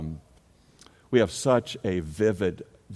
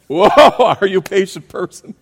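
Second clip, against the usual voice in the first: much higher and louder, teasing; loud playful challenge; 11 semitones higher, 15 dB louder than usual. Real, not acted. Preacher teasing the congregation about their patience. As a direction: call out the question to tease the room.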